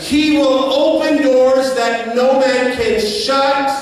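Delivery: rising preacher's cadence building to a shout; full-force preaching. Acted. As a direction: drive it home to the congregation.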